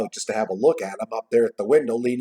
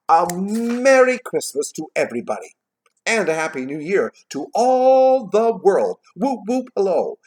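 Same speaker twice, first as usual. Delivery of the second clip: high, loud jubilant greeting; excited; 9 semitones higher, 7 dB louder than usual. A narrator's reading. Scrooge wishing all the world well, jubilant. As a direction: hail the whole world with stretched, raised words. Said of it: "when he gets a character excited".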